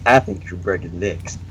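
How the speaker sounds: low, soft menacing gangster voice; lightly voiced mobster threat; mob boss voice. Shown as a demonstration. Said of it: "I can actually do that properly".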